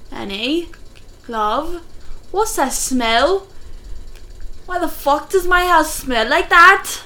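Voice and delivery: put-on British accent, drawn out. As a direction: play an outraged British spouse. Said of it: "That was your British accent".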